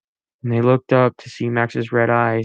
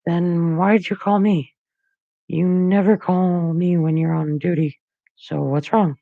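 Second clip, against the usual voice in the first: put-on higher anxious boyish voice; worried teen voice; 7 semitones higher than usual. A narrator's reading. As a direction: press him, worried and higher. Max voiced by narrator.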